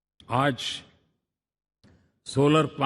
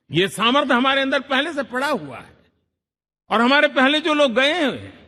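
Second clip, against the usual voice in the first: higher, louder rallying lines; rousing orator; 9 semitones higher, 4 dB louder than usual. Real, not acted. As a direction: lift the crowd with pride. Speech climax to crowd.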